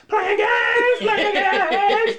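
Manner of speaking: high, loud laughing yell of the lyrics; screaming along. Retold or imitated. Fan shout-singing with the crowd at the concert. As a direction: belt the song at the top of your lungs. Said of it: "me, I'm like".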